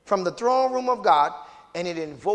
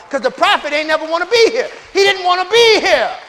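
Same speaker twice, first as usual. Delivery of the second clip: louder and higher preacher's climb; preaching at full force; 7 semitones higher, 10 dB louder than usual. Acted. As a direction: drive it home, rising. Preacher climbs in pitch and volume.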